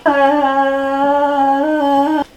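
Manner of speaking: long, loud held "uh"; frustrated loud groan. Acted. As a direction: let out a loud, drawn-out groan of writer's block. Stuck while trying to write the poem.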